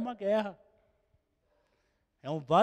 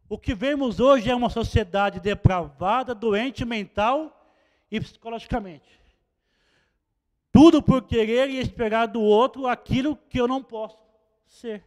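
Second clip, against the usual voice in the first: louder, denouncing preacher; preaching; 3 semitones higher, 9 dB louder than usual. Acted. Preacher condemning society to the church, loud and denouncing. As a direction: thunder against a sick society.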